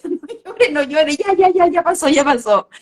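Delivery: high, laughing imitation of a parent shushing; mock hushing, laughing. Parent rushing a hurt child to stop crying, laughing mock hush. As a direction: poke fun at the hurry-up comfort.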